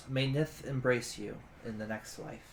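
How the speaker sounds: soft, low graveside blessing; solemn blessing. Acted. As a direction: say it gently over fresh graves. Blessing spoken over the graves.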